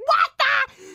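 high, loud startled shriek; startled retold yell, high and loud; shocked shout. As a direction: yell it like a bird just hit you. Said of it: "I was like".

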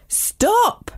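high-pitched storybook "stop"; sudden high cry of command; acted yell. A narrator's reading. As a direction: yell at a runaway pet, volume contained. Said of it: "I yelled at the dragon".

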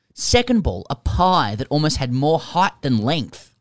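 dramatic ball-by-ball read-aloud; reading a listener's letter. Acted. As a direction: announce each ball, then tell it with relish.

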